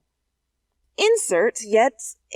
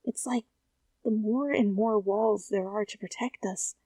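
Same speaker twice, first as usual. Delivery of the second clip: softer, confiding tone; lowered voice; 6 dB quieter than usual. Acted. Telling Lee about the secret drill, hushed confiding voice. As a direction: share a secret carefully. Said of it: "You cut yourself off and lowered your voice".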